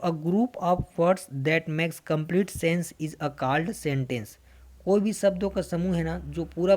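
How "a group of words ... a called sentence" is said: slow dictation. Acted. Teacher reads definition aloud.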